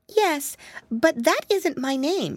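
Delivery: put-on high, fretful little girl voice; a tiny frightened mouse wailing; timid mousy girl. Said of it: "she said".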